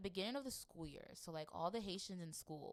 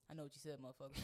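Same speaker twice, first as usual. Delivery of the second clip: low menacing mutter; 6 semitones lower, 11 dB quieter, much faster than usual. Retold or imitated. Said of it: "they're just like".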